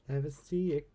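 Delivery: usual speaking voice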